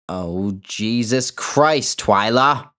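slow gasp of shock, then loud; startled cry. Acted. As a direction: caught off guard, call her name out. Startled woman cries out name.

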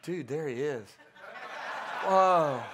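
low, hushed dude voice; hammed-up awestruck dude; mock casual awe. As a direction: say it low, like a laid-back guy spotting a celebrity. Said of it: "he can go".

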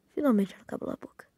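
fast, whispered relief; whispering. Real, not acted. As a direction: mutter it under your breath. Relieved aside after sister stops.

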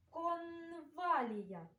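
"Конвалія" very slowly, middle N held; sound-position demo. Shown as a demonstration. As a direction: linger on the н in "Конвалія". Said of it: "де знаходиться звук Н".

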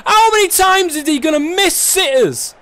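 loud, high exasperated ranting; angry rant. Real, not acted. Gamer ranting at the camera over missed sitters, exasperated. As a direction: keep venting at the wasted chance.

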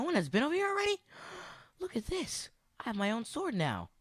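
giddy high exclamations; excited discovery. Real, not acted. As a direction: squeal over the find.

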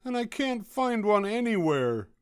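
high, loud plaintive character voice; pleading. A narrator's reading. Douglas pleading with the rabbit, high plaintive wail.

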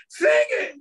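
high, shouted prompt; called out. Retold or imitated. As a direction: yell it at the kid to sing. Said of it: "I said".

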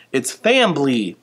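fambly said slow with an m-b cluster, FAM-blee; moose-speak fambly. Shown as a demonstration. Librarian shows kids how moose say fambly. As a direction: stretch out fambly slowly. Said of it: "instead of the word family".

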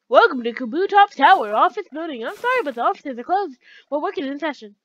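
loud, sing-song greeter voice; lilting, swooping official announcement; office receptionist. Acted. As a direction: play a receptionist turning visitors away.

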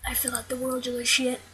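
soft unvoiced whisper. Real, not acted. Gamer mutters a gloomy aside in a whisper. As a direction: breathe it out under your breath.

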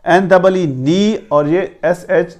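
ordinary talk, nothing marked

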